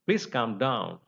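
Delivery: ordinary talk, nothing marked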